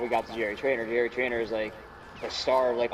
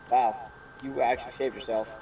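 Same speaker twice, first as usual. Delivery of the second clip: ordinary talk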